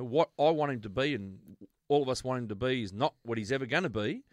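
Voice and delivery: normal talking voice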